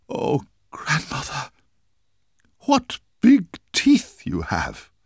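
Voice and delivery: put-on high, loud, slow frightened girl; frightened little girl. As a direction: cry out in growing alarm. Girl alarmed by teeth.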